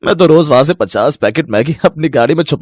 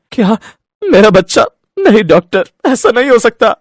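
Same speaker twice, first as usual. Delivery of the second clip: shocked grief; 8 semitones higher than usual. Acted. Father hears baby died.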